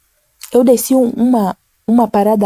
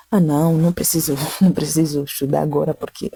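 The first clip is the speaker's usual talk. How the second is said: mock dismissive excuse, lower and softer; lazy excuse; 5 semitones lower, 4 dB quieter than usual. Preacher mimicking a student ignoring the voice, dismissive. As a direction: brush it off like a lazy teen. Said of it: "Você falar".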